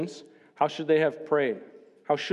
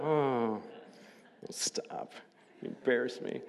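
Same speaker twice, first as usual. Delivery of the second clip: hushed, low, playful false modesty; mock bashful; 3 semitones lower, 9 dB quieter, slower than usual. Pastor joking to congregation after their applause, mock bashful. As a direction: play coy and drop to a near-whisper.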